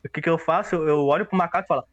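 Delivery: ordinary delivery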